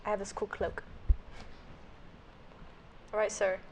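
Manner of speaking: usual speaking voice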